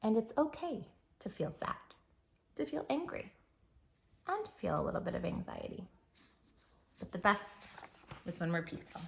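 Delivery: soft, quiet and calm; soft bedtime reassurance; gentle reassurance. Acted. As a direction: reassure a worried child softly.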